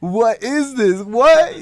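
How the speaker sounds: can't believe what they hear; stunned disbelief. Retold or imitated. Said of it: "we're like".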